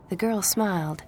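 usual speaking voice

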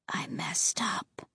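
unvoiced, soft whisper. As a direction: confess in a whisper. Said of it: "he whispered".

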